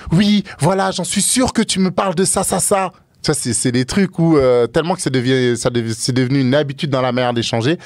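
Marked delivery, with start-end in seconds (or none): 0.1-2.9 higher-pitched mock accusation; retold indignant comeback; acted accusing partner. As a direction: retort as a suspicious partner would.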